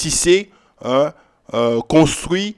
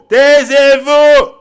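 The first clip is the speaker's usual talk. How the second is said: very high, loud, every syllable stretched; drawn-out yell; 11 semitones higher, 12 dB louder, much slower than usual. Acted. Rebuking inventors of lies a second time, long drawn-out yell. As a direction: yell it slowly, holding the vowels. Repeats the order, bigger and stretched.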